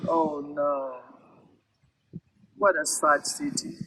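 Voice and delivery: plain reading voice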